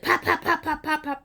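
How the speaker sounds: mother swatting son, mock smack sounds; imitated smacks. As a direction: pop it out like slaps landing.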